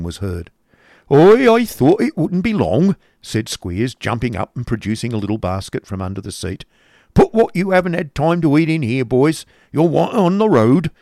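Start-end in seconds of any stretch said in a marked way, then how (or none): 1.1-3.0 high, loud, drawn-out rustic schoolmaster; coarse schoolmaster. Acted. Schoolmaster greeting the coach's arrival, coarse and loud. Squeers's dialogue in narrated story. 7.1-10.9 loud, raised-pitch schoolmaster voice; coarse schoolmaster. Acted. Schoolmaster hurrying boys onto the coach, coarse and loud. Squeers orders the boys along.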